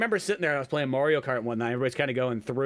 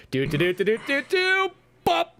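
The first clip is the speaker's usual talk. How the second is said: sung-out doo-doo taps rising high, then a bop; imitated knock pattern; 10 semitones higher than usual. Student knocking code through the classroom wall, imitated knock pattern. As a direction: tap out a rhythm with the voice.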